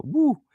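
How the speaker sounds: short high whoop of effort; high-pitched woo. Real, not acted. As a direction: let out a quick, high "woo". Exclaims at the step up.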